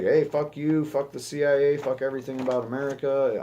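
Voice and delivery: a hammed-up defiant outburst; mock defiant shout. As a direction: fling it out like a loud taunt. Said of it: "Duterte's still like".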